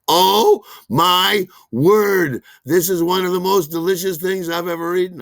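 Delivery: loud, high gushing over the noodles; mock rapture. The two rave about cold sesame noodles, loud and gushing. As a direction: gush like it's the best food ever. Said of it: "And they're like".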